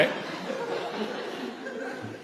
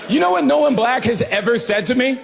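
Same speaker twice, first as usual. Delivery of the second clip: ordinary delivery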